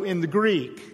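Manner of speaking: normal talking voice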